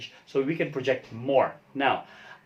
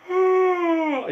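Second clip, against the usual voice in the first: high open yawning sigh; a big audible yawn on a sigh; demo yawn sigh; 5 dB louder than usual. Shown as a demonstration. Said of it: "just like that".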